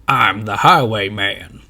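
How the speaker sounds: slow, loud drawn-out stage announcement; announcing. A narrator's reading. Highwayman announces himself to the crowd, slow and loud. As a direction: boom it out slowly from the stage. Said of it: "announces a man on the stage".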